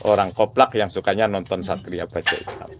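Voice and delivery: normal talking voice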